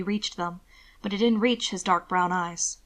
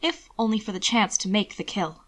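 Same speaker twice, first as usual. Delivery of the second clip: usual speaking voice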